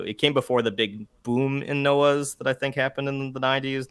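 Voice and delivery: ordinary talk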